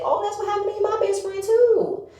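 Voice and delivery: high, fast, chirpy mimicry; lightly mocking Toya's chirp. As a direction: chirp it out like an eager girl. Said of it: "Toya said".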